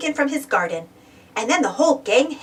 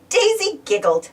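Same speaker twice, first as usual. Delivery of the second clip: ordinary delivery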